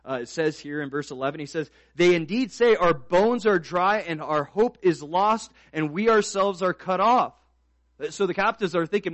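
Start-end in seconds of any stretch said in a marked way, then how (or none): 1.9-7.3 loud, raised pulpit reading; forceful scripture reading. Real, not acted. Preacher reading Ezekiel to the church, forceful scripture reading. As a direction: proclaim the verse, stretching the key words. Reads verse again.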